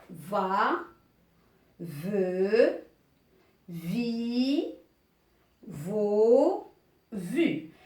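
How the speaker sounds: drawn-out syllables with pauses between; reading syllables aloud. Shown as a demonstration. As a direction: chant out each syllable slowly, one by one. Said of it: "Alors, je vais lire". (lecture des syllabes, lentement et bien détachées)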